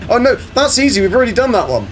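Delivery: oh please, that's nothing; dismissive surprise. Real, not acted. Answering a viewer's milestone claim, breezy and high. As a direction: pitch jumps up, brushing it off. Reacting to chat about milestones.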